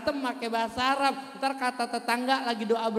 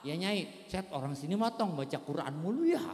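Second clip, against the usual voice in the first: put-on old woman voice; 5 semitones lower, 4 dB quieter than usual. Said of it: "kata nenek-nenek".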